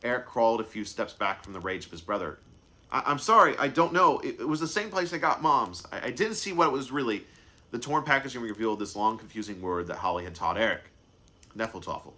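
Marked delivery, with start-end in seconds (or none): 2.9-7.2 put-on high, stammering, frightened boy; scared stammering. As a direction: stammer it out in fear. Boy backing away from brother's rage.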